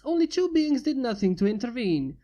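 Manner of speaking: ordinary delivery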